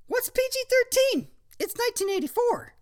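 put-on young woman voice. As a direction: greet him like a sweet girl. Phoebe voiced.

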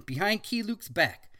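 normal talking voice

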